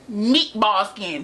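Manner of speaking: slow, stretched-out roast; deliberate, dragged-out dig; drawn-out insult. Acted. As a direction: draw out each word of the insult. Insulting coworker in a drawn-out read.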